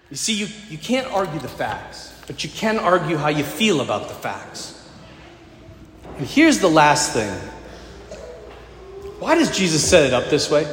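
ordinary delivery

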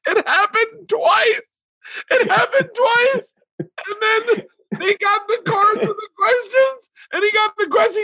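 very high, slow squeal through laughing; hysterical laughter. Real, not acted. Comic telling how it happened twice, in hysterics. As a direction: fight to speak while crying with laughter.

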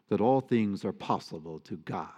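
ordinary talk

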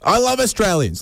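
high, mock-adoring exclamation; gushing enthusiasm. Imitating what you never hear back in Australia, gushing enthusiasm. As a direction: gush it with a high swoop, falling away. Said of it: "maybe we need more enthusiasm".

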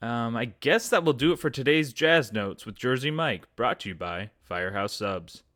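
normal talking voice

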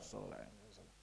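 hushed private blessing, almost silent; quiet to yourself. Shown as a demonstration. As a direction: say it under your breath to yourself. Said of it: "Just quietly you say it to yourself".

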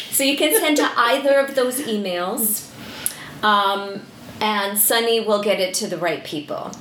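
ordinary talk

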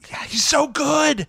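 high-pitched, drawn-out fan gushing; gushing delight. Real, not acted. Host gushes to guest about David Tennant's Scrooge, delighted squeal. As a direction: squeal it slowly with real delight.

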